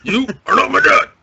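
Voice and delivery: high, loud mock dubbed villain line; hammed-up kung fu movie shout; kung fu dub retort. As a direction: throw it like a cheesy dubbed film.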